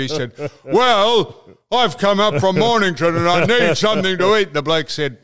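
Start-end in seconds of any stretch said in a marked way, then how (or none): 0.6-4.5 louder, higher, hammed-up protest; mock indignant Don. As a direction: squawk it like Don's outrage at being refused. Retelling Don's indignant complaint.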